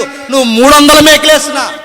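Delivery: loud, driving sermon cadence with punched phrases; fiery preaching. Acted. Preacher booming to the congregation about the cross. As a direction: preach it hard to the crowd.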